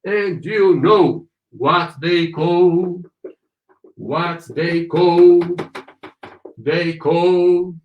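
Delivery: half speed, final "call" held long; slow model reading. Shown as a demonstration. Teacher leading students through the line, slow and drawn out. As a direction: draw out each word for repeating.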